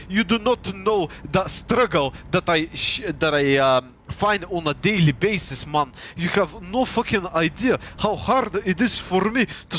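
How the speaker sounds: hammed-up Russian accent with labored phrasing; mock Russian accent. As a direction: imitate a Russian struggling with English. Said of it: "who doesn't speak like a fucking Russian".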